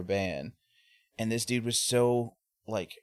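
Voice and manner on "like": ordinary talk, nothing marked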